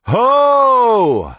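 call to halt. Acted. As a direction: call the riders to stop. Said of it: "One of the knights called".

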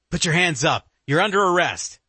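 commanding cop. Acted. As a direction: order them to freeze. Agent arrests suspect at gunpoint.

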